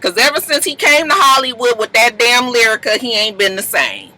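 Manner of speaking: usual speaking voice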